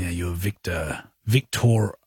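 low, slow mock Eastern European; Eastern European accent. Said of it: "It was Eastern European".

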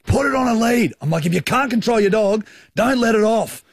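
yelling at the careless owner; retold angry shout. Angry at the dog owner, high sharp scolding.

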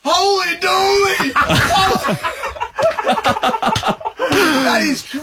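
excited laughing. Real, not acted. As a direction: burst out laughing, amazed. Sees the shaved face.

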